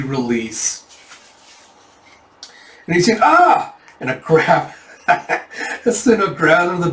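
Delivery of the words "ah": sudden high yelp; loud, high-pitched cry; acted scream. Bandit attacked by Sinha, sudden high scream. Bandit cries out when grabbed.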